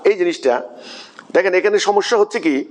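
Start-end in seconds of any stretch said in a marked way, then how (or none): none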